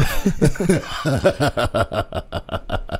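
a villain's gloating laugh; evil chuckle. Acted. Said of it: "That's a sinister laugh".

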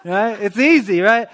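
normal talking voice

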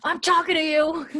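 high mock-angry call; acted-out indignant shout; retold yelling. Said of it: "he's yelling".

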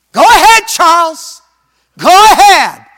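high, loud urging call; encouraging new voice. Retold or imitated. Preacher voicing the new voice urging Lindbergh on, loud and high. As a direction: cheer him on, go ahead. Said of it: "I heard a new voice".

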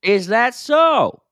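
slow high is that so; surprised. Acted. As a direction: drag it out, amazed. Mock surprise at garbage man.

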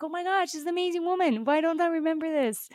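high, fast mock amazement at herself; gushing, amazed at forgetting her. Host recalls discovering Morgana, high fast amazed gush. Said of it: "And I'm like".

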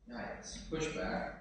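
softly, slowly, half under the breath; a quiet, uneasy aside; mock wince. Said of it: "And we're like".

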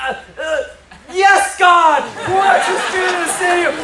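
high mock-startled stammer bursting into prayer; stammer, then high, hammed-up revival cry; Joy Dawson. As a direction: imitate a flustered elder swept into fervent prayer. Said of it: "And she's like".

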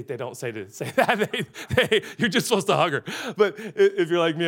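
talking through real laughter; laughing. Real, not acted. Preacher laughing as he corrects his own joke, laughing. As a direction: laugh as you backpedal.

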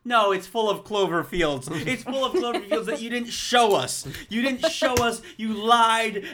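loud, high-pitched indignant rant; blustering outrage. Retold or imitated. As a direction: re-enact the fury at full bluster. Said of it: "how I was reacting to that ending".

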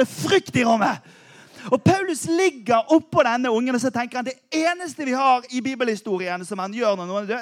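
ordinary delivery

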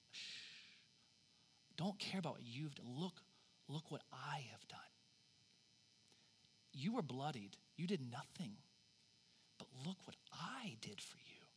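hushed, unvoiced whisper of God. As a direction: whisper it gently, like God hushing a sinner. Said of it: "He says".

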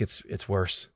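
low, very soft, halting realisation; hushed dread. Retold or imitated. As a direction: drop your voice as the bad news dawns. Retold fear the baby is worse.

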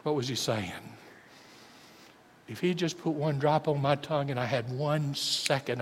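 ordinary delivery

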